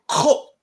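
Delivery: Ko barked short and loud with a throaty start; Klingon command. Shown as a demonstration. Teacher showing how to refuse, loud guttural Klingon Ko. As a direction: punch out a guttural, high Ko. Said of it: "you say".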